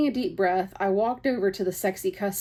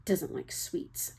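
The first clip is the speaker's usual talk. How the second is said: soft, slow muttering under the breath; muttered; 9 dB quieter, slower than usual. Acted. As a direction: grumble it to yourself. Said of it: "I muttered".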